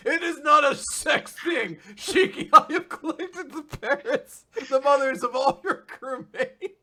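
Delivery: high, loud, slow villain proclamation, laughing through it; Ziggy's hammy villain voice. Pretend. Ziggy opens his speech to Shiki, hammy high villain voice laughing. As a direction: announce it grandly like a pompous villain. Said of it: "That's how he opens it".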